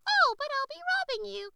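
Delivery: very high, loud cackling hen. A narrator's reading. The hen lady protests to the rabbit, cackling loud and high. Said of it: "cackled Miss Cluck Cluck".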